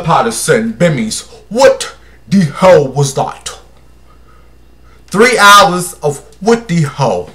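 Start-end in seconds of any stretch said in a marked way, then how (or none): 1.5-3.6 slow, put-on African accent. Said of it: "In my African voice". 5.1-7.3 slow, put-on African accent. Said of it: "In my African voice".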